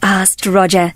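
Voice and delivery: plain reading voice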